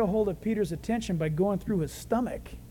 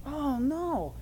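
mock dismay; 3 semitones higher, 7 dB quieter than usual. Peter's dream turns into nightmare.

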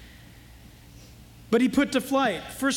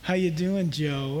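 low sultry voice; 7 semitones lower than usual. Retold or imitated. Preacher's corrected take of Potiphar's wife, low and sultry. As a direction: purr it like a seductress. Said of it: "I think she talked maybe like".

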